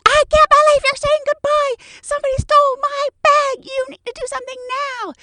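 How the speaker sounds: very high, very loud near-scream; hyperventilating panic. Retold or imitated. Panicked passenger screams at attendant, very high and loud. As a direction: shriek it out of breath. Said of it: "panting, hyperventilating".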